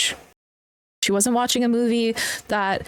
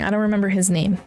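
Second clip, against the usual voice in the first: ordinary delivery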